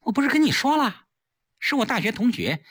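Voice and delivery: high, irritated retort; exasperated. A narrator's reading. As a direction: answer a suspicious husband testily. Wife answers suspicious husband.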